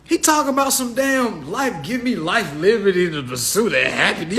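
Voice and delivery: high, laughing disbelief; incredulous mockery. Comedian as onlooker baffled by the patriot, high laughing disbelief. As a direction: crack up in disbelief at the guy. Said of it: "I'll be like".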